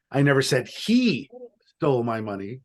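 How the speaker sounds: "he" stressed and held; single out "he". Shown as a demonstration. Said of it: "depending on which word I emphasize".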